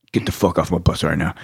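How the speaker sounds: low, spent instructor order; hoarse frog voice. Retold or imitated. Hoarse instructor clearing the bus, imitated frog voice. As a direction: order them off in a low croak. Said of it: "got that frog voice".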